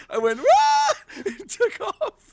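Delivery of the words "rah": a shrill, loud shriek way up high; high girly scream. Retold or imitated. Fleeing a huge snake, a high girly scream. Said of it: "screamed like a girl".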